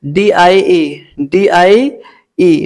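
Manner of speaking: letters D I E drawn out very slowly; spelled out slowly. Shown as a demonstration. Teacher spelling डीआईई slowly for students. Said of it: "डाई means".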